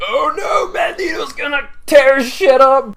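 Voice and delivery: high, loud, thrilled yell; excited fan shout. Retold or imitated. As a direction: cheer it like a hyped-up fan. Gleeful fan reacting to movie moment.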